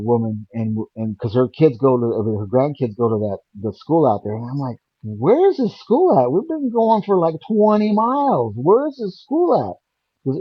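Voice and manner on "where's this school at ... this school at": high, drawn-out retold complaint; exasperated disbelief. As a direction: re-enact baffled impatience in a raised pitch. Retold complaint on long drive.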